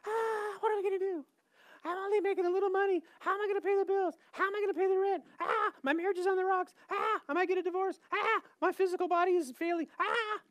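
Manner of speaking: very high, whining panic voice; squealed, exaggerated fretting; mock freak-out. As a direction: wail each worry like a hammed-up crybaby. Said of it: "that's what we sound like to God".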